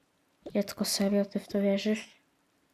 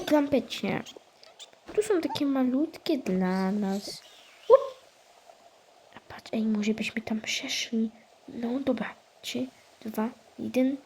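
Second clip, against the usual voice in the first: ordinary delivery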